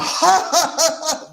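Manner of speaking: imitation of exuberant Italian laughter; loud mock laugh. Host illustrating an expressive culture, demonstrative hearty laugh. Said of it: "They're very loud".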